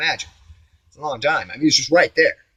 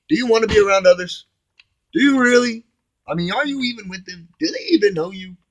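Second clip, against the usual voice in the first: much higher, louder, probing voice; high questioning voice; 9 semitones higher, 6 dB louder than usual. Pretend. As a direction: put it to him as a challenge. Questions himself in another voice.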